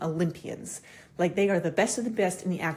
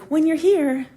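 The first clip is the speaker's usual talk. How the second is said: excited clients; 9 semitones higher, 7 dB louder, slower than usual. Retold or imitated. Quoting eager clients.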